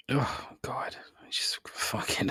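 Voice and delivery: whispered near-sob of despair. Real, not acted. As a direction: breathe it out, on the verge of crying. Devastated about the lost interview.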